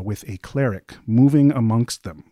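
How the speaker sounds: ordinary talk, nothing marked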